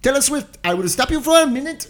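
put-on high, loud Indian accent; Gandhi West voice. Friend improvising Gandhi West interrupting a singer. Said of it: "it'd be like".

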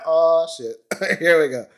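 high, loud 'oh shit, here we go' with a laugh; mock dread, laughing. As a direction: laugh it off as the trouble starts. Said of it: "We're like".

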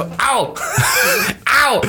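mock moans. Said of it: "wie redest du dann so".

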